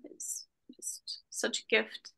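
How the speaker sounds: slow, moved whisper, voice nearly gone; emotional whisper. Real, not acted. Guest moved speaking of her children, slow whisper. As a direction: let the gratitude choke it down to a whisper. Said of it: "why I'm so emotional today".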